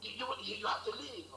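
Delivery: put-on a landlord demanding rent from the mother, in an Igbo accent. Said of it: "that was where I learned my Igbo accent".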